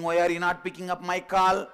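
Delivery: loud, high nagging rant; an angry girlfriend scolds her boyfriend, loud and high; screaming girlfriend. Acted. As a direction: scold him loudly one question after another. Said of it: "she's screaming".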